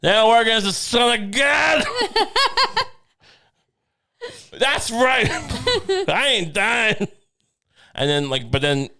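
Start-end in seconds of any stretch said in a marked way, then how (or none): 0.0-7.1 gloating mock shout. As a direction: jeer at them like a playground bully. Jesus mocking hammer-wielders.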